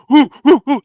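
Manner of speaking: high, loud whoops; crowd cheering. Retold or imitated. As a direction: whoop like teammates after a big hit. Crowd cheering after the hit.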